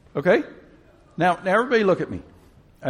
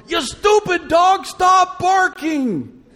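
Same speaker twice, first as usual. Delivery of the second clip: high, loud, slow mock shout; mock yell at dog; 12 semitones higher, 5 dB louder, much slower than usual. Preacher pretends to yell at his barking dog, high mock shout. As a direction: bellow at the dog in pretend frustration. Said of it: "stand up in my house and go".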